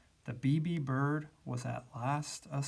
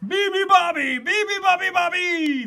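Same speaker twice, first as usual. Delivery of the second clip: loud, high animal chorus; bellowed bird-call chant; roared; 11 dB louder than usual. A narrator's reading. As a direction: roar the chant at full voice. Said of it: "they roared".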